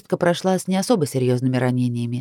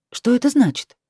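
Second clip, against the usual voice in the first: surprised; 7 semitones higher, 3 dB louder than usual. Acted. Startled question to him.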